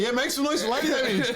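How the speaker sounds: high, loud hype announcing; MC hype call. Retold or imitated. MC hyping the crowd for [name], loud high call. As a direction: pump up the crowd for the DJ. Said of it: "I got an M C".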